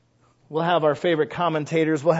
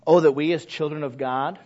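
ordinary talk, nothing marked

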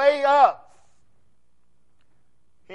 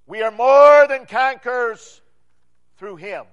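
loud proclamation, hit "more" hard; booming forth; 7 dB louder than usual. Shown as a demonstration. Preacher to congregation, letting the verse boom: loud. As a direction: let it reverberate through the hall. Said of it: "and boom forth".

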